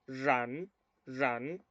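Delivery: "run" opened with a buzzing zh-like R; Mandarin R in run. Shown as a demonstration. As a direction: narrow the mouth on the R of "run", no trill. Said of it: "pronuncian conmigo".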